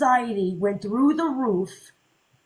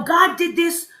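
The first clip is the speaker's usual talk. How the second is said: mock angry fan; 4 semitones higher than usual. Retelling the fan's outburst at Ivory, mock angry shout. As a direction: throw the fan's accusations back, rising to a yell. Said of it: "And then it's like".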